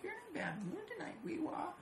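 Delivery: put-on very high baby-talk teasing; mock taunting. Imitating her taunting the cat, high teasing voice. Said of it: "like, taunting Kiwi".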